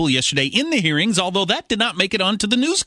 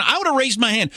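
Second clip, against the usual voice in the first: ordinary delivery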